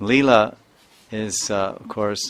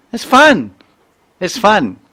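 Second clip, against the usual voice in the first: put-on very high, loud kid's exclamation; gleeful child imitation; 12 semitones higher, 12 dB louder, slower than usual. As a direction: burst out like a delighted child. Voicing child's gleeful answer.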